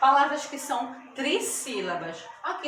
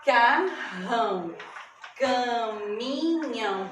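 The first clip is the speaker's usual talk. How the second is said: ma-car-rão, ca-mi-nhão in long slow beats; split into syllables; much slower than usual. Shown as a demonstration. Teacher giving three-syllable examples, syllable by syllable. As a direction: sound out macarrão and caminhão slowly. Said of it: "Tem vários exemplos de trissílabas".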